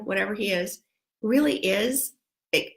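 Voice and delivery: ordinary talk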